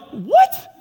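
Jonah can't believe it; stunned what. Retold or imitated. Jonah stunned by God's eight-word message, high shocked what.

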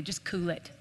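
soft, laid-back grandpa advice; a hint of easygoing grandpa; papa's voice. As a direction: toss it off gently like papa. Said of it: "that's how he talked to me".

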